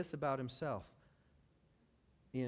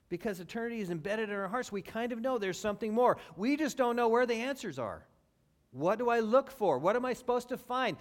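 loud, higher, urgent preaching; rising preaching; 7 semitones higher, 6 dB louder than usual. Acted. Preacher asking the church aloud, building. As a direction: build the questions up.

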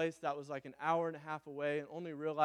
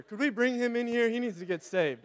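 ordinary delivery

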